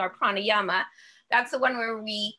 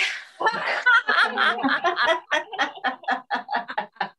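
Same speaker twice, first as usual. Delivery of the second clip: high, open burst of laughing; real laughter; 6 semitones higher, 4 dB louder than usual. Real, not acted. Teacher laughs after the breath release, real laughter. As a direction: let the laugh roll.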